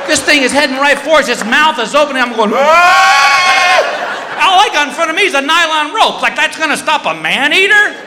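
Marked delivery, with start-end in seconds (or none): 2.5-4.4 high loud panicked scream; terrified scream. Retold or imitated. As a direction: scream as the shark charges. Shark swimming at him.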